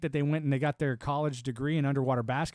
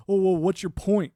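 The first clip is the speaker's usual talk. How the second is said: mock challenge; 6 semitones higher, 8 dB louder than usual. Quoting challengers.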